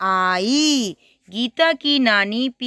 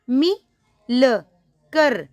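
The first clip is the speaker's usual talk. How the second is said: मिलकर broken up and said very slowly; slow syllable reading; 2 semitones higher, much slower than usual. Shown as a demonstration. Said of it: "छोटी ई की मात्रा है, तो आपने आवाज़ क्या निकालनी है".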